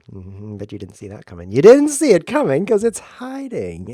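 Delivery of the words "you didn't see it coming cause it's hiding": high, loud, drawn-out gloating; mock triumph, stretched and loud; hammed-up crowing. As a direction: crow slowly over your own pun. Hammed-up crowing over own pun.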